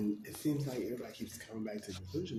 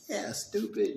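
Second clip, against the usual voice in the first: mock insult; 12 semitones higher, 5 dB louder, slower than usual. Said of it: "You even call me stupid".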